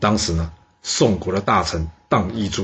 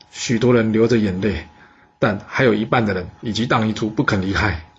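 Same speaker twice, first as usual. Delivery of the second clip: usual speaking voice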